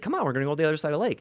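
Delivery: rushed, tumbling preaching; going too fast. Real, not acted. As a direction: race through it at double speed. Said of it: "I'm going too fast again".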